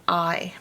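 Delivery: I said as "eye"; long I sound. Shown as a demonstration. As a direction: say I like the letter's name. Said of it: "makes its long sound".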